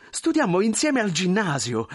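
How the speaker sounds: high, excitable character voice; effusive thin man, overjoyed. Acted. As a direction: gush and show off the family to an old friend. Thin man carries on gushing.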